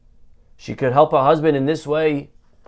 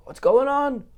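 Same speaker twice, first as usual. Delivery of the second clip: panicked cry; 11 semitones higher, slower than usual. Acted. Panic in crisis acted.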